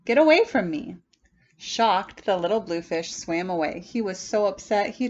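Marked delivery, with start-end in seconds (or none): 0.1-1.0 loud, sharp brush-off; angry. Acted. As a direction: drive the little fish away. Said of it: "cried the Rainbow Fish".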